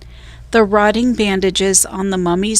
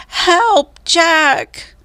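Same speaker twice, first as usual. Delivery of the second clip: distant cry for help; 10 semitones higher than usual. A narrator's reading. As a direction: scream for help from far away. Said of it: "The voice sounded far away".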